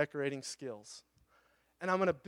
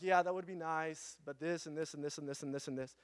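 mock excuses; 7 dB quieter than usual.

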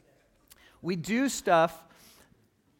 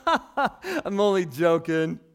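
passive-aggressive joking; 3 semitones higher, 11 dB louder than usual. Acted. Preacher quoting the man who deceives his neighbor, passive-aggressive. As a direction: play the deceiver laughing off the damage. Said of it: "That's so passive-aggressive".

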